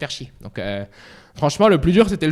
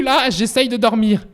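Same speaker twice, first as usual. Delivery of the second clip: usual speaking voice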